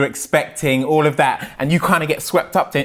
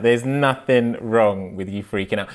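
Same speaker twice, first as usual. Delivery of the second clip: lower, settled repeat for emphasis; reassuring; 6 semitones lower than usual. Real, not acted. Friend tells worried dad freaking out is fine, reassuring. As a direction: bring the pitch down and reassure.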